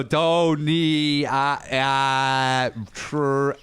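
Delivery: halting, drawn-out sounding out of syllables; struggling to read. Retold or imitated. Pretending to the lady he can't read, haltingly sounding out. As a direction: fake getting stuck on every sound. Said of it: "let me try to read it".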